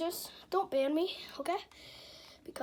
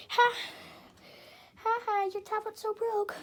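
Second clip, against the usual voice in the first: mocking taunt; 6 semitones higher, 5 dB louder than usual. Shown as a demonstration. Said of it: "you're gonna say".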